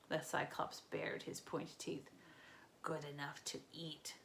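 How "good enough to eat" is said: softer, low hungry whisper; menacing whisper. A narrator's reading. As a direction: savour the threat.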